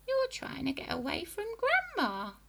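put-on high, taunting old hag; sly accusing witch; witchy grandma voice. A narrator's reading.

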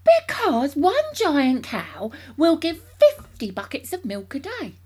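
high, loud, slow emphasis on the numbers; grandly carried away; excited cry. A narrator's reading. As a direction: proclaim it with huge enthusiasm. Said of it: "cried Mister Cranky, waving his arms".

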